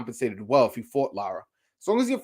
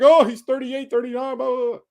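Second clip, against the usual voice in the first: higher-pitched mimicry of doubters; mocking objectors; 8 semitones higher, 5 dB louder than usual. Imitating people who object to Erislandy Lara's age, mockingly. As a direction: parrot the naysayers in a raised voice. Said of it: "some people are gonna be like".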